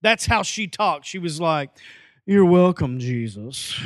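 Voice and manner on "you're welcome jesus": talking-cat voice. Retold or imitated. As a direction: say it like a talking cat, sinking away. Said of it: "if a cat could talk and had a voice".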